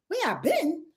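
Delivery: high-pitched echoed question; mock incredulous comeback. Answering the accusers back, mock incredulous. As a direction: throw the question back in disbelief.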